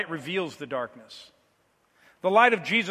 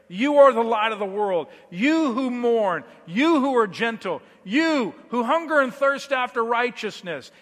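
louder, higher sermon litany, each you hammered; fervent preaching; 4 semitones higher, 4 dB louder than usual. Acted. Pastor calling each believer by name, fervent preaching. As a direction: preach the refrain like a drumbeat.

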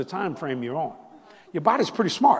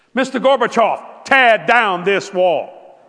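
very loud, slow, declaiming; orator's delivery; 5 semitones higher, 13 dB louder, much slower than usual. Retold or imitated. Preacher quoting a famous speech to the congregation, loud and slow.

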